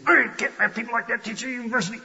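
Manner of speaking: hammed-up huffy outrage at professors; huffy and angry. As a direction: sneer it like an indignant critic. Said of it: "Don't get all huffy and angry and say".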